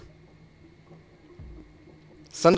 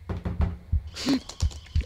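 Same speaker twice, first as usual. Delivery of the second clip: sharp gasp; 12 semitones higher, 8 dB quieter than usual. Real, not acted. Caught by monster.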